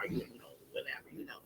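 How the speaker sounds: dismissive near-whisper, breathy and unvoiced; a light, low-effort impression of a hater. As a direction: brush them off under your breath like a hater. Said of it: "I gotta talk to y'all how y'all haters are talking".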